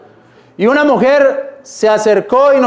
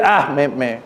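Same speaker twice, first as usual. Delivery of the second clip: moved listener; 5 semitones lower, slower than usual. Retold or imitated. A hint of a moved listener stammering to the preacher. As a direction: stumble over me, lightly overcome. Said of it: "y dice".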